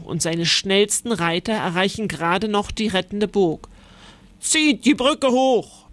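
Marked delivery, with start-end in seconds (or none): 4.4-5.9 slow, high, firm order; commanding knight. A narrator's reading. Knight Kuno orders his men to raise the bridge, slow high command. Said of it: "befiehlt er".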